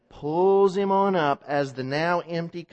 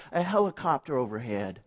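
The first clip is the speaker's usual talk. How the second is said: ordinary talk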